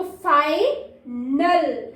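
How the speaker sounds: Final drawn out very slowly; teacher reading the word slowly for children; slow model reading. Shown as a demonstration. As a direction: stretch final syllable by syllable. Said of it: "तो आई open हो जाएगा".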